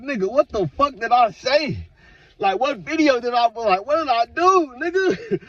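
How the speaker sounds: high, slow, exasperated mock rant; high put-on voice, baffled and fed up. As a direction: plead with the stalkers in disbelief. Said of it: "I'm like".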